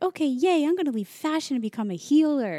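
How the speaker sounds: high loud sarcastic yay; mock cheer. Imagined inner voice cheering, high, loud, sarcastic. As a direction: fake enthusiasm for becoming a healer. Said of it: "I still wasn't like".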